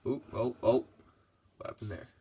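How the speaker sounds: usual speaking voice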